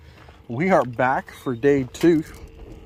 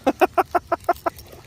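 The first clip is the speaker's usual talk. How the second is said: high laugh; 3 dB louder than usual. Real, not acted. Laughs at repeated catch.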